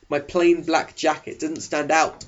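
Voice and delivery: usual speaking voice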